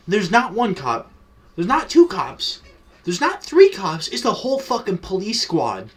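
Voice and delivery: storyteller's escalating disbelief, peaking high; hyped-up reveal, rising step by step; mounting alarm. Retold or imitated. Escalating count of cops at door.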